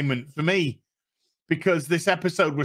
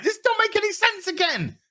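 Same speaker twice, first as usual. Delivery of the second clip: ordinary delivery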